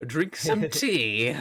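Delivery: slow, high, loud British voice; hammed-up posh Brit; British accent. Mocking English stereotype.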